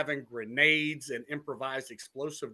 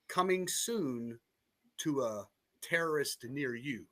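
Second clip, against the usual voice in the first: horror trailer voice; 3 dB quieter than usual. Pretend. Ominous tagline after drone scenario.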